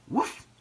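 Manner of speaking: short, high imitated woof; dog bark. A narrator's reading. Ebb to the following baby seal, barking. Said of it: "she barked".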